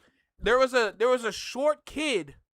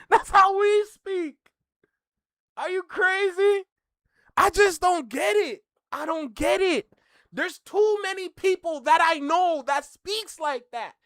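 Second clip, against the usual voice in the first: high, raised-voice indignant rant; exasperated disbelief; 7 semitones higher, 4 dB louder than usual. Real, not acted. Streamer arguing that his people speak like that, exasperated. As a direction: protest loudly, pitched up in disbelief.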